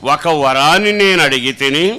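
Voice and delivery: loud, fervent scripture repeat; preaching at full force. Acted. Preacher echoing the psalm reading to the congregation, loud and fervent. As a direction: proclaim the verse back with fervour.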